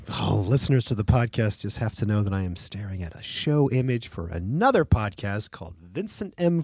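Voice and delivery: ordinary talk, nothing marked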